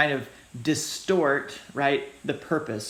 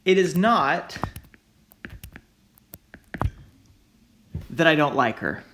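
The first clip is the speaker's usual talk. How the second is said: "not" raised and loud, then plain "that I don't like her"; stress on NOT; 3 dB louder than usual. Shown as a demonstration. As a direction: pause after "not" to press it. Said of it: "Somebody says".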